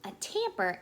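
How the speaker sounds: ordinary delivery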